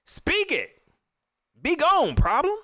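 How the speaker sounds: very high, forceful banishing command; mama's exhortation. Retold or imitated. Streamer tells a problem to go like mama, high rebuke. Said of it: "that's what my mama tell me".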